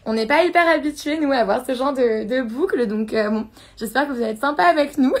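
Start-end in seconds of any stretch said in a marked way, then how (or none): none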